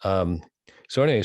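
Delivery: normal talking voice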